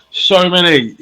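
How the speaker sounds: high, loud "so many"; emphatic exclamation. Real, not acted. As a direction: burst out with "so many". Exclaims over endless choices.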